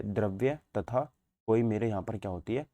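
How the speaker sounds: usual speaking voice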